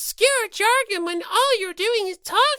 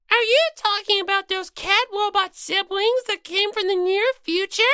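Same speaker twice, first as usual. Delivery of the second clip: ordinary talk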